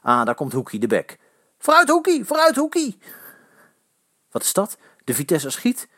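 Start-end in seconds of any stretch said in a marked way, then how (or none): 1.6-3.0 high, loud cheering; urging shout. A narrator's reading. Fan urging Hoekie on from the sideline, loud yell. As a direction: yell him forward from the stands.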